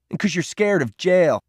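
high, goading jab; scornful accusation; angry taunt. A narrator's reading. Peter continues the taunt.

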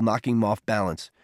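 ordinary delivery

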